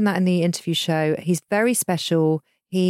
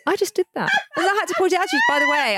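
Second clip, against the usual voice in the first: high petulant voice; 12 semitones higher, faster than usual. Retold or imitated. Retold petulant repeat.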